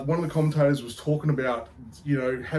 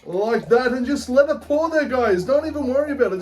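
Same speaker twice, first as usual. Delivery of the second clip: higher pitched, loud hammed-up Irish lad accent; 7 semitones higher, 3 dB louder than usual. Beer show host greets punters, hammed-up Irish accent. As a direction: put on a cheeky Irish brogue. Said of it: "I don't know how long I can keep this accent up for".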